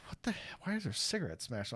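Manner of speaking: half-voiced, hushed puzzled mutter; muttered confusion. Baffled teen muttering at the smashed cigarettes, hushed. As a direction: mutter it under your breath, baffled.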